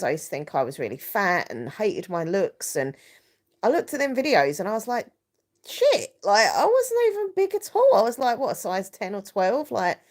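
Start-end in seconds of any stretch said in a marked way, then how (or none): 5.6-7.9 high-pitched disbelieving outburst; retold shock. Reacting to old videos of their younger self, retold shock. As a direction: pitch leaps right up in disbelief.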